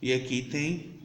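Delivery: ordinary talk, nothing marked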